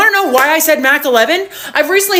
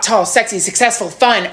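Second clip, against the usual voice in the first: ordinary talk, nothing marked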